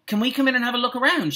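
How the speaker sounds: put-on high, fast boy's call; eager high-pitched question; shouted request. A narrator's reading. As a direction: pipe up quickly, high-pitched. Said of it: "shouted Colin".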